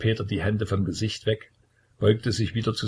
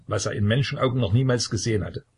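plain reading voice

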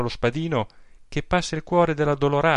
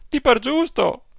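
outraged cry; 12 semitones higher, 5 dB louder than usual. Acted. Aunt repeats, more outraged.

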